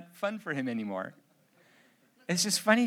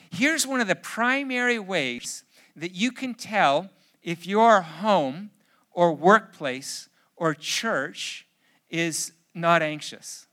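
ordinary talk, nothing marked

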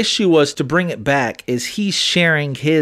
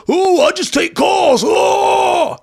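much higher, louder shouting; heated shout; 4 dB louder, slower than usual. Retold or imitated. Arguing with her friend about texting, shouting higher. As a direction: yell the point across the room. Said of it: "It's not".

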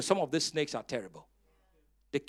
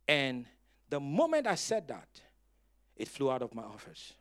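ordinary talk

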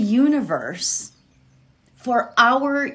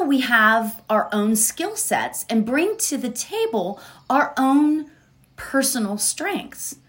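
ordinary talk, nothing marked

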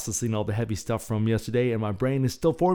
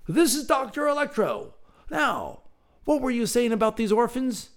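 high British gentleman's voice; Rutherford's voice. Acted. Rutherford introducing Doctor Electro and asking, British gentleman voice. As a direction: ask briskly like an English gent.

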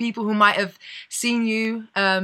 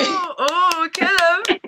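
excited cheer; 8 semitones higher, 3 dB louder, much slower than usual. Real, not acted. Cheering the accent attempt.

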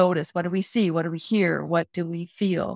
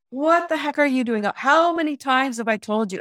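high, scolding rebuke, pitch up; imagined Jesus scolding the praying person, high and berating; 6 semitones higher than usual. Pretend. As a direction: tell someone off like a fed-up parent. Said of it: "and just berates you".

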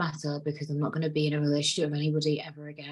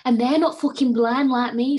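higher and louder, heated; heated rant; 6 semitones higher, 6 dB louder than usual. Real, not acted. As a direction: hit it hard in fierce defence. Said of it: "I went on a little rant".

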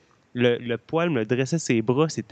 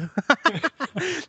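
short laugh; 14 semitones higher than usual. Real, not acted. Laughs at own confusion.

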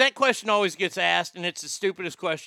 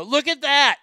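hyped-up; 7 semitones higher, 5 dB louder than usual. Real, not acted. Bragging announcement.

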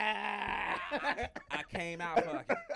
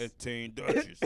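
much lower, raspy put-on voice; deep raspy voice; 10 semitones lower than usual. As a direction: drop the voice low and rasp it. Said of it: "It's, it's raspy like that".

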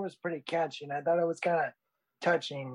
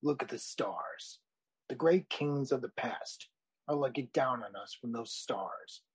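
Mufasa; 3 semitones lower, 4 dB quieter than usual. Acted. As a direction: deliver it as a wise king teaching his son, softly and unhurried. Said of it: "you sound just like Mufasa from The Lion King. Very noble and wise".

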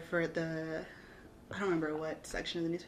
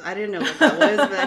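louder, laughing through the words; 3 semitones higher, 12 dB louder than usual. Real, not acted. Guest laughing at her younger self, talking through the laugh. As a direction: chuckle your way through it, amused at yourself.

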